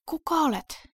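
high, strained hoarse voice; weakened, high-pitched croak of a question. A narrator's reading. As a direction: ask hoarsely, pitched well up. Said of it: "Johanna kysyi käheällä äänellä".

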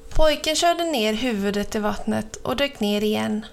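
plain reading voice

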